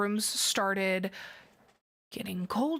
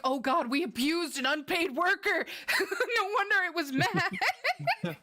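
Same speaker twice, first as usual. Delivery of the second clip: mock horror, breaking into laughter, much higher; laughing horror; 7 semitones higher than usual. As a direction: cry out in horror while cracking up. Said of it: "And I'm like".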